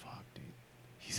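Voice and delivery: hushed, awed whisper. Real, not acted. Comic fan whispering in awe about a favourite writer. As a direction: breathe it out under your voice.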